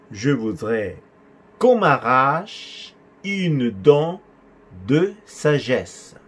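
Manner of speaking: broken into chunks, syllables drawn out; very slow repeat. Shown as a demonstration. Teacher repeating a patient's request, very slow and segmented. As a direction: dictate it chunk by chunk for learners.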